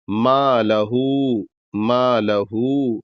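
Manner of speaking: ما لہو with the long vowel stretched; drawn madd. Shown as a demonstration. Teacher showing the madd to students, slowly. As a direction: stretch ما two to three beats. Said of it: "دو سے تین الف کے برابر کھینچنا".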